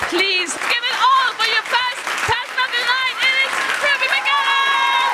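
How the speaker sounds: high, rousing stage shout; announcer introduction. Real, not acted. Host bringing on the night's first act, rousing announcer. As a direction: whip up applause, drawing out the name.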